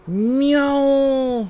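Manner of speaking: long drawn-out meow, high and loud; playful, high held meow; cat meow. Pretend. Imitates a cat to show pet.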